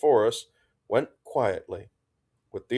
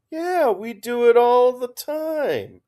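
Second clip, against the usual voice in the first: high, slow, airy tease; breezy needling tone; light teasing; 6 dB louder, slower than usual. A narrator's reading. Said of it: "Dix added in a light tone".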